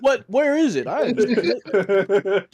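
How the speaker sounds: loud, high, eager voice with a laugh; mock excited dupe. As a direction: play the gullible friend, squealing. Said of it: "He's like".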